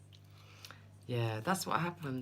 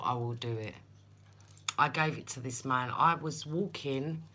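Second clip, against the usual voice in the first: ordinary delivery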